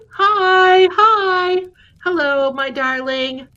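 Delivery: high sing-out hello to a friend; excited greeting. Real, not acted. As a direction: greet them high and loud, drawn out. Greets a viewer who just arrived.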